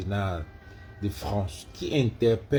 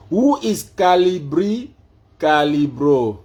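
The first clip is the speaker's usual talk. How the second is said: high, loud, stretched syllables; loud slow query; 6 semitones higher, 8 dB louder, slower than usual. Real, not acted. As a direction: say it slowly and loudly for a device. Retrying slowly for the assistant.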